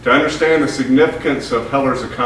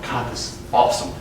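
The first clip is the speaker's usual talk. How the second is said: whispered thought; 3 dB quieter than usual. Retold or imitated. Inner thought of excitement.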